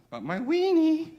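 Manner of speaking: high, loud, drawn-out punchline; squealed punchline. Acted. Mock teacher propositioning a student, high slow squeal. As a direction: swoop way up and stretch the last word.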